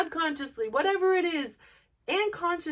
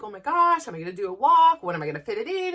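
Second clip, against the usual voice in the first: mock panic; 4 semitones lower, 8 dB louder, faster than usual. Mimicking her former self panicking about fitting in a walk. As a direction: ham up the old dread of the daily walk.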